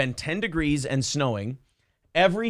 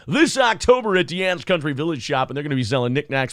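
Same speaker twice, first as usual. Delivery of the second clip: fast, nasal local radio ad read; hammy small-town radio commercial; 3 semitones higher than usual. Shown as a demonstration. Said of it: "I didn't go up into my nose and go".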